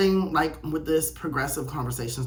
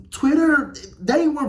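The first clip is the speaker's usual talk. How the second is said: emphatic exclaim; 10 semitones higher, 7 dB louder, slower than usual. Real, not acted. Emphatic punchline.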